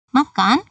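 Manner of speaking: "Makan" lifted high at the end; rising question. Shown as a demonstration. Teacher gives the Indonesian equivalent of the question, rising intonation. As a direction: ask "Makan" with a rising final syllable. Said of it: "artinya seperti itu, sama ya".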